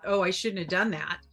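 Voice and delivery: normal talking voice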